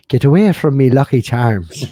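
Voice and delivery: loud Irish leprechaun cry; playful Irish brogue, punched up loud; Irish accent. Acted. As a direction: call it out in a mock Irish accent. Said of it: "very good for an Irish one".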